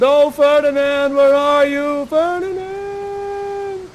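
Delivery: loud call rising into a long high wail on the name; calling for Ferdinand. Acted. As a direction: cry the name out, stretching it. King calling again for son.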